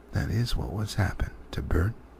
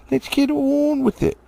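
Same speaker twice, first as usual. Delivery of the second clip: higher, impatient; high troll urging; 4 dB louder than usual. Acted. Troll urging the others before dawn. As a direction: hurry the others along.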